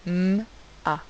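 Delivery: a long hummed "M", a pause, then "A"; isolated phonics sounds "M, A"; separate sounds. Shown as a demonstration. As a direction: sound out "M, A" one sound at a time. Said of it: "deux sons".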